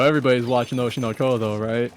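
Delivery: ordinary talk, nothing marked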